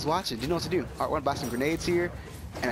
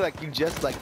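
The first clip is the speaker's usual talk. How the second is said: normal talking voice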